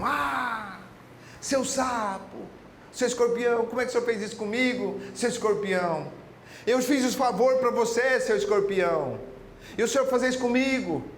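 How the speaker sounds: high, stung frog crying out in hurt disbelief; betrayed frog, pained. Acted. Stung frog protests to the scorpion, high and hurt. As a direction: cry out like a betrayed friend.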